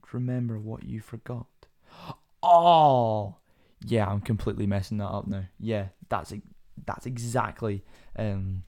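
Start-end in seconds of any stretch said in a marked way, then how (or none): 2.4-3.4 sharp loud gasp of realisation; a sudden gasp as it clicks. Real, not acted. Gasping as the podcast host realises the joke.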